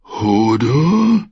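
long, mournful drawl; sad. A narrator's reading. Hodor grieving over the wounded maester, sad. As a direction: draw the name out sorrowfully. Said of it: "sagte Hodor traurig".

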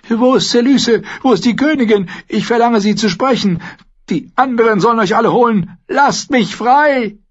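high, frantic shouted demands; desperate shouting. A narrator's reading. Prisoner demanding the queen from his guards, shouting. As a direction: scream your demands at deaf ears. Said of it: "Die Wachen hörten nicht auf sein Geschrei".

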